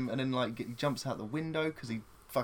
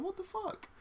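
mock shocked mum; 7 dB quieter, slower than usual. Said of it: "She's like".

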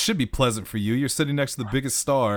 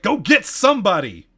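sharp shouted order, higher and louder; 8 semitones higher, 7 dB louder than usual. Acted. Jonathan yells at the guard to go get somebody, loud. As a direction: snap at the guard to fetch someone. Grabs notepad, smacks the guard.

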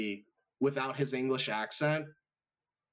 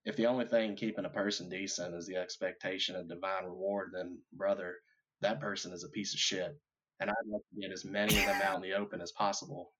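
Rusty Cohle impression; 6 semitones lower, 3 dB quieter than usual. Guest quoting True Detective, low brooding Rusty voice. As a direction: brood like a weary Texas detective. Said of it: "you thought you were talking to Rusty Cole".

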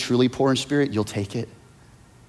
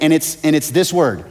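normal talking voice

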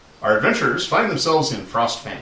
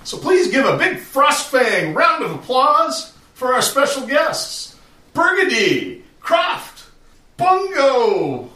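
high, loud pompous ruler; pompous lord; 11 semitones higher, 5 dB louder than usual. Acted. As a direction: proclaim like a jolly self-important jarl. GM voices the jarl's speech.

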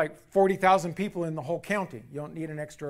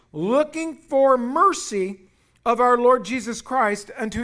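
slow, louder preaching; preaching with fervor; 4 semitones higher, 5 dB louder, slower than usual. Acted. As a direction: drive the point home to the congregation. Preaching at full force.

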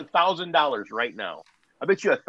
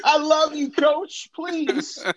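mock tearful plea; much slower than usual. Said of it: "mock tearful plea".

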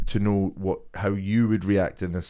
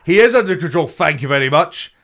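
much higher and louder, mock indignant; imitating a loud retort; 6 semitones higher, 6 dB louder than usual. Friend replaying the retort to the cyclist, loud mock indignation. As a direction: belt it out like an offended chef. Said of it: "the chef, the chef".

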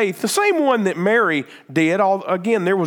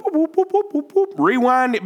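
mock rewind noise; 7 semitones higher than usual. Imitating a movie rewind sound.

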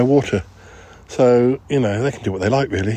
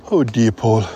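normal talking voice

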